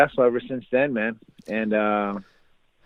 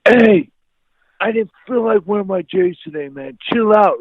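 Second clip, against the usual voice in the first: high, loud exploding retort; angry outburst; 13 semitones higher, 4 dB louder than usual. Retold or imitated. Teen fed up with friend's sneaker questions, erupting. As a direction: re-enact erupting at a pestering friend. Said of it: "I exploded like a volcano".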